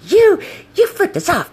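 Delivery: mimicked high, loud accusation; retold screaming. Passenger accusing the scooter rider, imitated screaming. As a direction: shriek it as the outraged passenger. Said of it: "we're just screaming at each other".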